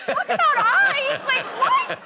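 high, loud, drawn-out incredulous question; baffled disbelief. Retold or imitated. Actress baffled by director's vague instruction, high laughing disbelief. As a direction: squeal it back in comic bewilderment.

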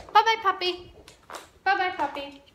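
high sing-song bye-bye puppy; dog goodbye. Shown as a demonstration. Trainer saying goodbye to her dog in a high sing-song. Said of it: "then I can tell him".

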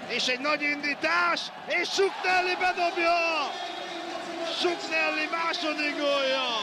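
excited goal call. Real, not acted. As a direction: call the goal with rising joy. Goal call in live commentary.

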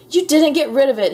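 high, loud storybook outburst; accusing shout. A narrator's reading. Frizzle discovers the ghost is still there, shouting. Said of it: "he shouted".

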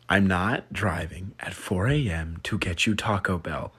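slow hushed whisper. Real, not acted. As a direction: whisper it, half asleep. Refusing 4 AM food run.

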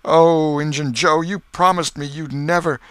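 high, loud, pleading cry; anguished exclaiming. A narrator's reading. Potter seeing Joe and reminding him of a promise, anguished exclamation. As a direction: cry out in betrayal, breaking off. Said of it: "and exclaimed".

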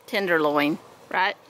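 normal talking voice

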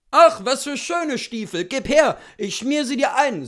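loud, high-pitched exclamation; loud and eager coachman; excited admiration. A narrator's reading. Coachman admiring boots, calling out.

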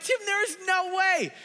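mock doubtful congregation. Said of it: "you're like".

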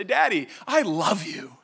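put-on slow, sugary child voice; butter up daddy slowly; sweet little daughter. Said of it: "she will say".

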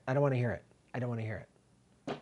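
fading repeat; fading away. Real, not acted.

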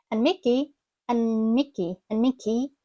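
"And Mickey" as "an-Mickey"; dropped d. Shown as a demonstration. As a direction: drop the d in "And Mickey". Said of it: "швидко кажемо and, без D".

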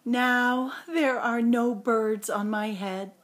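ordinary delivery, nothing marked

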